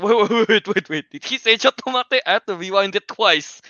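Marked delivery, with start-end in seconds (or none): 0.0-2.2 high, louder startled wait wait; stunned double take at a line; disbelieving surprise. Retold or imitated. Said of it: "I was like".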